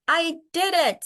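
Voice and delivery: put-on a boy thrilled he made it; proud triumph. Jabari to his dad, high proud triumph.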